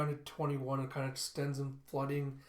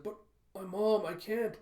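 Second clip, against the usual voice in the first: put-on distressed child; 7 semitones higher, 4 dB louder than usual. Child won't leave mother.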